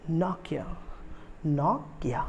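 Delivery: Nokia broken as "NOCK-ya"; correct Finnish pronunciation. Shown as a demonstration. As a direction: say Nokia with a short open first vowel. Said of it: "it is".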